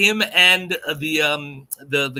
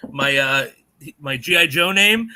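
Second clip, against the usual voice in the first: ordinary talk, nothing marked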